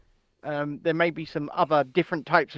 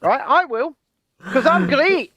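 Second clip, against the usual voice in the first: higher, louder Scottish-tinged Galloway voice; mock George Galloway; 9 semitones higher, 5 dB louder than usual. Boasting to David in a loud mock George Galloway voice. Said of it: "As him would say".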